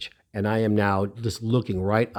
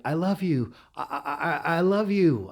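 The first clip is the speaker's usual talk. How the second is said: higher, stammering adoration; starstruck gushing; 7 semitones higher than usual. Retold or imitated. Young fan looking up at his idol, starstruck gushing. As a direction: gush it helplessly, tripping over the words.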